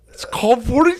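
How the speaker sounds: high, loud, cracking retold reply; blurting it out while fighting tears, laughing; squeaky embarrassed shout; choked-up high yell. Retold answering Phil while crying.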